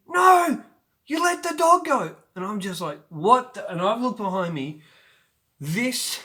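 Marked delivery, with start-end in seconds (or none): 0.1-2.2 much higher, loud alarmed shout; girl yelling that the dog got loose, high and loud; retold yell. Girl calls out dog released. 3.1-3.7 loud startled what the; boy hearing a shout behind him, loud startled question. Retold or imitated. Startled by the dog.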